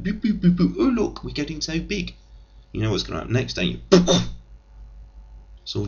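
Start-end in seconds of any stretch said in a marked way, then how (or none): 0.0-2.1 put-on high, childlike growth noises; mock childish America. Comic mocks America growing big, high childlike glee. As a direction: play America as a giddy toddler.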